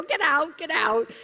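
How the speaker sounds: high, loud repeated order; high, loud insistent yell; shouting him out. Retold or imitated. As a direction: chase him off. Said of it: "I go".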